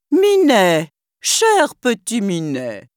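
sugary, slow coaxing call; honeyed villain calling the cat; wicked stepmother. Acted. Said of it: "d'une voix doucereuse".

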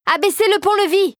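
high-pitched called command; calling out. A narrator's reading. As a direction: call the order up to the guards. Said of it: "lança la fée rosée".

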